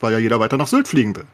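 ordinary talk

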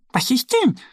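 high little duck voice; little duck asking. Acted. Duck Annie asks the sighing bear, high and light. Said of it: "fragt Annie".

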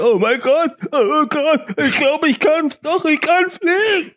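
very high, squeaky thrilled moth; excited moth voice. Acted. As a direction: voice the overjoyed moth, high pitched. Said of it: "die so gerade dachte".

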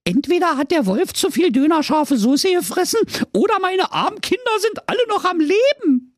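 high musing mother; high hopeful mum voice; mother goat voice. A narrator's reading. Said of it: "dachte die alte Geiß".

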